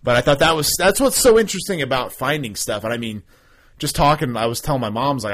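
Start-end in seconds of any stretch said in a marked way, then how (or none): none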